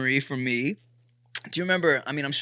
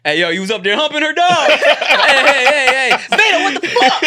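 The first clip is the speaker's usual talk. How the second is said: loud, high, excited hollering; mock outraged dad; 11 semitones higher, 8 dB louder than usual. Future dad practising double standards, mock outrage. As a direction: heckle in hammed-up alarm. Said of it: "I should practice my".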